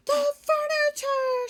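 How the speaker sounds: high, loud shocked shriek; cry of dismay. Acted. Lady seeing her ruined furniture, high loud shock. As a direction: gasp it out in horror. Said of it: "she cried".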